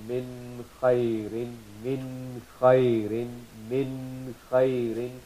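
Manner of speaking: slow min khairin, the n of min sounded clearly before the kh; clear izhar. Shown as a demonstration. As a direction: draw out min khairin with a distinct n each time. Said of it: "We're doing the izhar of the noon".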